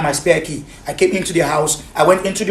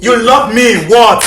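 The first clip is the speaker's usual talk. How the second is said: raised, higher, indignant retort; incredulous anger; 4 semitones higher, 4 dB louder, slower than usual. Real, not acted. Husband answering wife's declaration of love, incredulous anger. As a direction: throw it back in disbelief.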